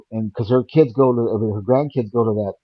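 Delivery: ordinary talk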